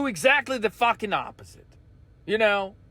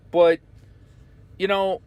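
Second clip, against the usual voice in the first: ordinary delivery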